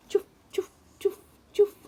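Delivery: breathy chuff chuff, rising high; shoes on path. Retold or imitated. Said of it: "They go".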